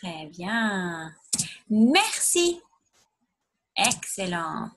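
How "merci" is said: Merci with a French r, pitched much higher; teacher modelling Merci in a high voice for pupils. Shown as a demonstration. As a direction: model Merci brightly for the class to echo. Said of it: "Can you say merci".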